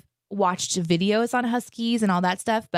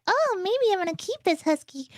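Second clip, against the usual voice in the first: much higher, light swooning voice; mock smitten musing; 13 semitones higher than usual. To a co-host, recalling falling for the husky, a high smitten coo. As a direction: coo it like you've fallen for the dog. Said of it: "I'm like".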